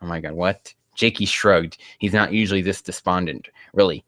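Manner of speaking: ordinary delivery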